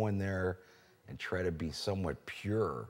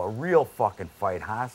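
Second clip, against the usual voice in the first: louder, higher taunt; retold challenge; 5 semitones higher, 9 dB louder than usual. Trader taunting the man who punched him, loud. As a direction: fire it back at him. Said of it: "I go".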